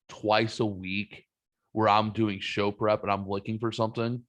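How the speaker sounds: ordinary talk, nothing marked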